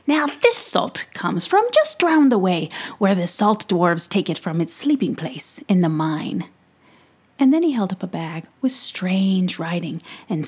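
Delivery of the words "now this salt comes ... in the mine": louder, hearty merchant voice; jolly pixie peddler. Acted. Peddler teasing young Tom about salt, hearty and louder. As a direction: sell it like a showman at his cart.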